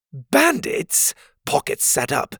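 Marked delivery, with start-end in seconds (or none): 0.1-1.1 high, offended echo of bandits; outraged disbelief at the word; indignant. Acted. Pocket offended by accusation.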